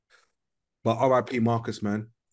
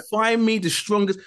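retold demand; 7 semitones higher than usual. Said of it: "I told him".

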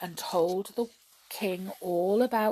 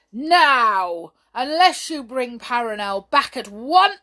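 loud, forceful royal warning; angry threat; 4 semitones higher, 11 dB louder than usual. A narrator's reading. King threatening the brownies, loud and angry. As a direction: lay down the ultimatum at full volume.

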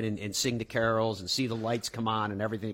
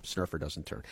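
usual speaking voice

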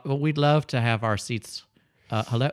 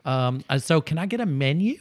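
loud American tourist; 4 semitones higher than usual. Acted. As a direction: play the brash American tourist, big and friendly. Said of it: "here comes an American customer now".

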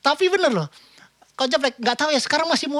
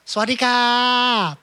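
mock Thai greeting; much slower than usual. Greeting fake Thai doctor.

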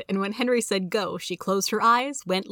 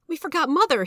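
higher, urgent read-aloud cry; 8 semitones higher, 4 dB louder than usual. A narrator's reading. Henry realising they forgot Mother, crying out. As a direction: call it out in mild alarm. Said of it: "he cried out".

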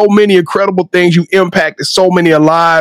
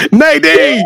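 laughing through the name; 7 semitones higher than usual. Real, not acted. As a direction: call the name while laughing. Host laughing while calling her out.

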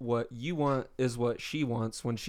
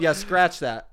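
louder, laughing retraction; 4 semitones higher, 8 dB louder, slower than usual. Real, not acted. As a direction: laugh it off while taking it back.

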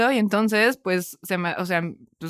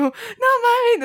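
mock dismay. Reacting while crying in the story.

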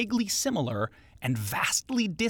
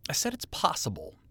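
voiceless whispered aside; 2 semitones higher, 5 dB quieter than usual. Acted. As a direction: whisper it defensively.

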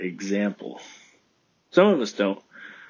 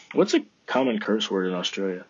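ordinary talk

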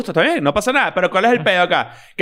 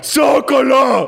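shouted celebration; 5 semitones higher, much slower than usual. Retold or imitated. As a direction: yell it like a fan in the stands. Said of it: "mira esto".

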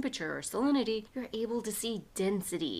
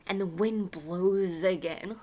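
slow windy telling; 5 dB louder, slower than usual. A narrator's reading. Cold wind blows over the class.

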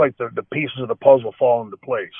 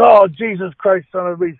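louder, higher, slowed mock outburst of pain; retold cussing outburst; 5 semitones higher, 6 dB louder than usual. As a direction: ham up the injured wrestler swearing about his nose. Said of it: "starts going off again".

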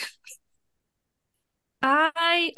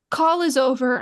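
ordinary delivery

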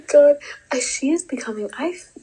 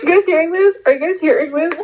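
indignant call-out; 7 semitones higher, 6 dB louder than usual. Real, not acted. Calling out to the audience.

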